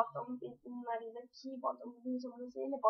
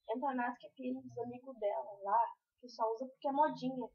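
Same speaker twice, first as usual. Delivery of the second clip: normal talking voice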